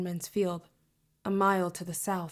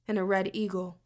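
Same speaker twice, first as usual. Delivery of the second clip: ordinary delivery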